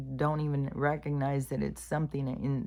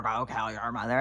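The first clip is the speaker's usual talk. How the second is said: voice in his head; 3 semitones lower than usual. Retold or imitated. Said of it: "how the voice is telling you".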